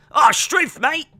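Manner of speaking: high, loud mock Aussie exclamation; bad Australian accent. Doubling down on a bad Australian accent after mocking it. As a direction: throw out a cheesy Australian cry. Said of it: "that was the worst Australian accent ever".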